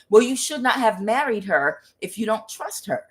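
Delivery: ordinary talk, nothing marked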